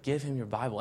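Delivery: a hint of a still small voice from God. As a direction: speak it gently like a quiet voice in your head. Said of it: "I hear it again".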